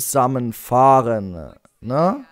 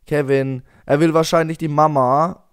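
usual speaking voice

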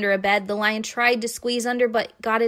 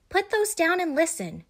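put-on bossy girl; 9 semitones higher than usual. A narrator's reading. Bossy sister.

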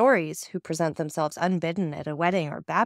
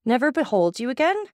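higher, louder emotional outburst; raised, impassioned storybook exclamation; 7 semitones higher, 3 dB louder than usual. A narrator's reading. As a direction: exclaim it in moved disbelief. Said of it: "exclaimed Emmanuel".

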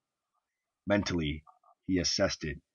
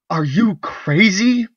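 much higher, louder, slow "Are you crazy?"; indignant outburst; 12 dB louder, slower than usual. Acted. Wife refusing her drunken husband, outraged slow cry. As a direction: fling it at him in outrage.

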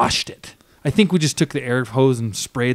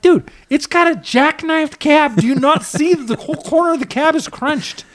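much higher, pleading with the buyer to look; incredulous; 14 semitones higher than usual. Retold or imitated. Telling the fooled Canadian guy about the crunched cab, high and incredulous.

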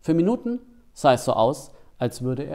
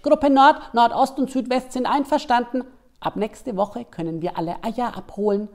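hare voice; 9 semitones higher than usual. Acted. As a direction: squeak it out like an excited rabbit. Der Hase meldet Pläne. (hohe, laute Hasenstimme)

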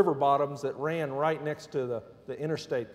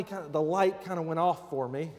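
ordinary talk

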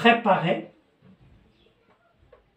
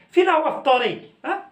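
mock demanding question; 9 semitones higher, 3 dB louder, much faster than usual. Acted demanding husband.

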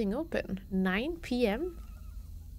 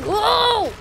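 excited whoa; 7 semitones higher, 9 dB louder than usual. Real, not acted. Excited exclaim in gameplay.

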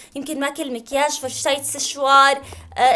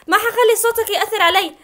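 ordinary delivery

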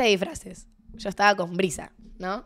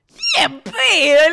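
slow, high, loud no; refusing the cheek kiss, slow and loud; loud refusal; 7 semitones higher, 8 dB louder, much slower than usual. Retold or imitated. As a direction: drag it out defiantly.

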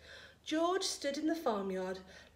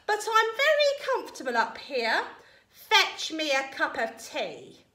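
imperious grandma; 6 semitones higher, 6 dB louder than usual. A narrator's reading. Grandma demanding tea from George below, imperious. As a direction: call down a haughty command.

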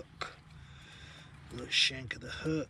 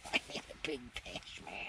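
quiet laugh; 4 semitones lower, 9 dB quieter than usual. Real, not acted. Laughing quietly at his bad luck.

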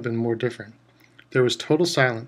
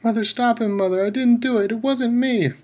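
much higher, desperate cry; frantic pleading; 10 semitones higher, 4 dB louder than usual. A narrator's reading. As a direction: beg his mother to stop it. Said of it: "screamed the boy".

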